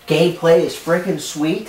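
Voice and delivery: loud, high outraged question; outraged he stole the line; indignant. Acted. Accuses him of copying.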